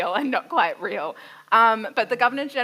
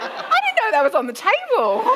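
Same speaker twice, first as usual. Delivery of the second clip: loud, laughing retort, higher; laughing disbelief; 12 semitones higher, 4 dB louder than usual. Retold or imitated. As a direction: blurt it out through laughter. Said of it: "And I'm like".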